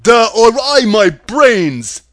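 goofy, drawn-out loud complaint; wailing Scarecrow. A narrator's reading. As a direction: lament it as a tearful dimwit. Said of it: "wailed the Scarecrow".

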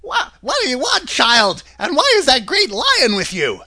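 high, startled woman's voice at the door. A narrator's reading. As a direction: play a wary housewife, pitched high.

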